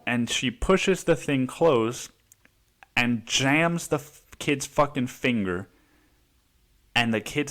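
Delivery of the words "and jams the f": ordinary talk, nothing marked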